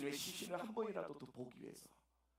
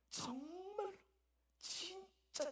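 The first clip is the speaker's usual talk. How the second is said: much higher, ringing emphasis; emphatic; 5 dB quieter, much slower than usual. Real, not acted. Preacher insisting on Mary's true love, much higher. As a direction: lift it up high to insist.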